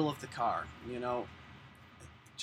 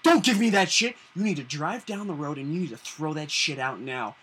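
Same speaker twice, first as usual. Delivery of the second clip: angry cop; 9 dB louder than usual. Retold or imitated. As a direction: bark it like a furious officer, half mocking. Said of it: "this guy was so pissed off".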